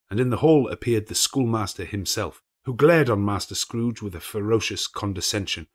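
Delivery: usual speaking voice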